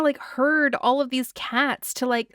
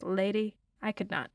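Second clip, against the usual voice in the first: ordinary talk, nothing marked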